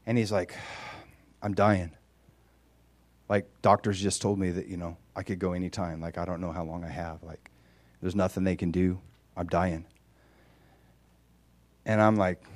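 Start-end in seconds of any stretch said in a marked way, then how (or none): 1.4-9.9 much softer, hushed and heavy; imitating a grieving man, barely above a murmur; dying man. Voices dying man's confession.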